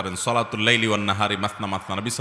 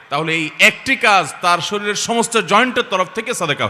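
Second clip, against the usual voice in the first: raised, ringing sermon delivery; emphatic preaching; 7 semitones higher, 5 dB louder than usual. Acted. Preacher teaching a hadith to a large gathering, emphatic preaching. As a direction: proclaim it to a big crowd, high and loud.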